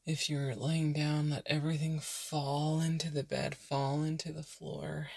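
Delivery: unhurried meditation voice with long pauses; guided meditation. Real, not acted. Podcast host leading burned-out listeners into breathing, calm meditation voice. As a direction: softly guide them into rest, leaving space to breathe.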